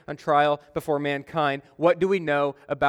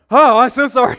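higher, much louder flustered sorry; embarrassed apology; 8 semitones higher, 12 dB louder than usual. Retold or imitated. Preacher realizing the caller was real, flustered. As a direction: blurt out a mortified apology.

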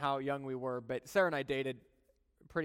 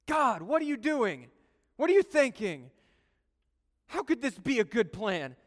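raised, higher frustrated cry to God; angry at God; 7 semitones higher, 6 dB louder than usual. Retold or imitated. Believer angrily questioning God in pain. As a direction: demand answers from God. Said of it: "we get so angry at Him".

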